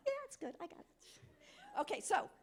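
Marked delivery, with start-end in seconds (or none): 0.1-0.9 high mock-proud voice; lightly voiced cocky volunteer; voicing the smiling man. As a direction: play his pleased reply, up high. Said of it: "look at his smile".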